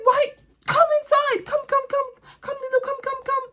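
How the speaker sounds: very high sing-song calling of the dog; coaxing the dog. Retold or imitated. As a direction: call Lulu in like a pet owner at the door. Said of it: "I'm like".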